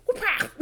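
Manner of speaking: a silly high "Whoop-ah!" zap sound; mock spell cry. Hagrid casting at Dudley, a high mock yelp. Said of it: "He does not do that with his voice".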